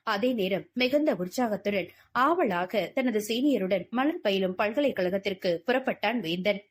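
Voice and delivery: ordinary delivery, nothing marked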